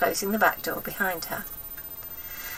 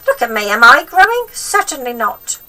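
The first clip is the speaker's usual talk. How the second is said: put-on high, loud cranky old woman; bossy wicked granny, pitched up and loud; grandma voice; 5 semitones higher, 11 dB louder than usual. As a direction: nag the boy like a spiteful old grandma.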